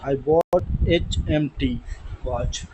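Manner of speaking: each word of uniform on Wednesday drawn out; slow dictation. Shown as a demonstration. Teacher reading the example to students, slowly. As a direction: spell it out slowly for the class.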